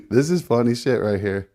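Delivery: usual speaking voice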